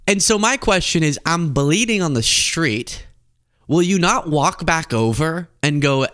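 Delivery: ordinary delivery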